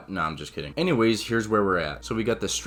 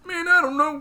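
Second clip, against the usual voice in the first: a much higher, louder whiny shrug of a voice; clueless farmer; 6 dB louder than usual. Retold or imitated. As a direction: play the baffled farmer squeaking out an excuse. Farmer answering the officers.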